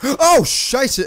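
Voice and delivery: high, loud startled gasp. Real, not acted. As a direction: gasp as if ambushed. Gasp at sudden enemy.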